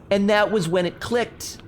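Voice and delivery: usual speaking voice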